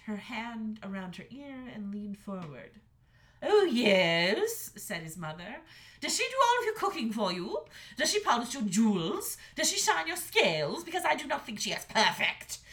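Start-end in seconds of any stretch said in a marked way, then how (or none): 3.4-12.6 high, loud sarcasm; mock each question; sarcastic dragon mother. A narrator's reading. Mother dragon mocking her son with sarcastic questions.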